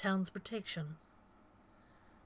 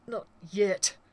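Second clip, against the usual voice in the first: gritted out; 3 semitones higher, 6 dB louder than usual. Acted. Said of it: "Collier gritted out".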